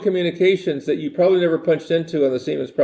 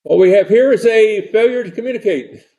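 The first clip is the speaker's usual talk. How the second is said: put-on higher, louder Southern accent for the famous line; Southern-accented movie line, pitched up; Southern drawl quote; 6 semitones higher, 5 dB louder than usual. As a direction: deliver it like the Southern prison captain, raised and loud. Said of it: "This guy is Struthers Martin".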